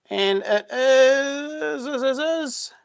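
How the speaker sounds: high, slow teasing build-up before the name; playful slow announcer tease; drawn-out suspense. Real, not acted. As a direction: stretch it out like a drumroll. Drawn-out suspense before naming winner.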